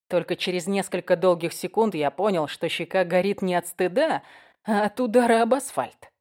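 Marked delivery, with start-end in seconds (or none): none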